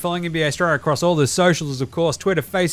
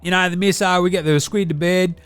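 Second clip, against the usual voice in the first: Deli character voice; 2 semitones higher than usual. Acted. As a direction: play the crude Aussie uncle reviewing telly. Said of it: "It's your old man Deli over here".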